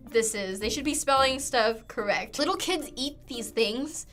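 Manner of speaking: normal talking voice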